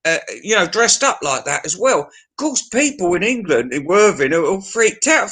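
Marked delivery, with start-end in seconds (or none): none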